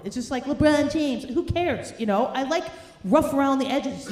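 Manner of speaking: usual speaking voice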